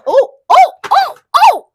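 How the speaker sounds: very high, loud oh's; excited squeal. Real, not acted. Sudden excited outburst.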